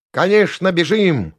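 high, loud, cheeky reply; perky. A narrator's reading. Unexpected voice eagerly agrees to run, perky. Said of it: "ответил задорный голос".

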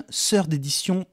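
Third said like French sœur d', with an s; sœur d'édition, French-style with s for th; French mispronunciation. Shown as a demonstration. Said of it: "vous le prononcez comme vous voulez".

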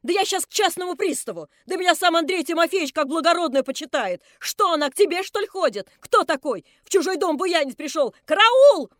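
high, loud screeching abuse building to a scream; shrieking shrew. Acted. Drunk landlady screeching at the intruder, building to a scream. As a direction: screech at him and cry for help.

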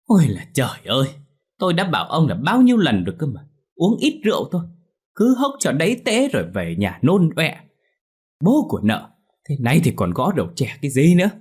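high-pitched wifely tirade; angry yelling. A narrator's reading. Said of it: "nóng mắt mà quát lên". (nóng mắt quát mắng)

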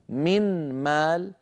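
"من مال" slowly with a distinct n, unmerged; clear noon. Shown as a demonstration. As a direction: separate the n of "من" from the m of "مال". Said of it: "كان النطق".

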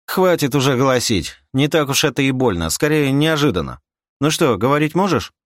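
high, loud, dismissive chiding; brusque bonesetter. Acted. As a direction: brush off the patient's pain. (бодрое ворчание костоправа)

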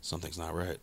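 very quiet, low voice in the head; inner thought. Acted. Listener's uneasy thought while someone talks, very quiet. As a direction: drop low and soft, as if thinking it. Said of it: "in your brain, you're like".

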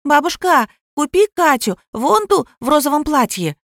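put-on high-pitched five-year-old pleading; little girl. Girl begs grandmother for a doll, high childish pleading. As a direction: voice an excited small child begging. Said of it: "вертлявая девочка лет пяти".

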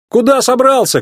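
high-pitched indignant call; crying out. A narrator's reading. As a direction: call after someone walking off. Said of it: "крикнул он".

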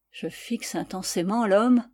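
ordinary delivery, nothing marked

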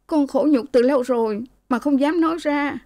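put-on high, aggrieved young woman's voice; tearful daughter. A narrator's reading. Daughter confesses her long suffering to parents, high and tearful. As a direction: confess a long-held shame. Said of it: "Cô Lễ nói".

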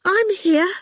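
a scared squeak trying to comfort; tiny squeak of false courage; small squeaky voice. Acted. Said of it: "in a small, squeaky voice".

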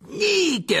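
very high, loud hearty roar. A narrator's reading. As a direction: bellow the name across the room. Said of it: "rugit-il". (rugissement sonore et aigu)

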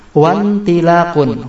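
wantilakun with WA running straight into N; hamzah wasal dropped. Shown as a demonstration. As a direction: join wawu onto wantilakun, no initial I. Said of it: "kalau kita kasih wawu menjadi". (wantilakun, wawu langsung disambung ke nun tanpa bunyi i)

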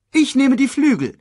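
high, loud claim; called out. A narrator's reading. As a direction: call out your share eagerly. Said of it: "rief Shenzi".

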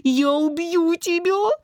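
high, drawn-out furious cry; enraged threat. A narrator's reading. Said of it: "взревел Илья". (яростно, высоким голосом)

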